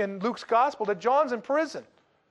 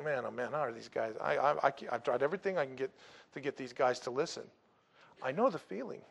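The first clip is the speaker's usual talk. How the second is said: exasperated mutter; 8 semitones lower, 10 dB quieter, faster than usual. Retold or imitated. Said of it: "And John's going".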